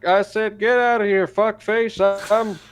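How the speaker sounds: high, loud old president impression; old politician rambling at the pool; presidential voice. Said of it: "That's my, uh, presidential voice".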